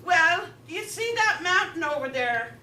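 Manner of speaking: hint of an old farmer sizing someone up; lightly voiced farmer. Farmer answers director in joke.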